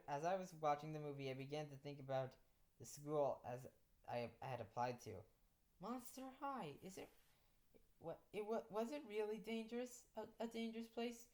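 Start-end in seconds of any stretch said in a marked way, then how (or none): 5.8-11.2 high, slightly hushed inner questioning; wondering to oneself. Acted. New teacher doubts Monster High, wondering to herself. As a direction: wonder aloud in a higher voice. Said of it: "I thought to myself".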